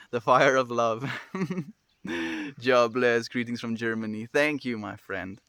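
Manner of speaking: ordinary talk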